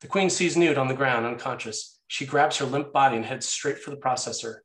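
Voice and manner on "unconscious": usual speaking voice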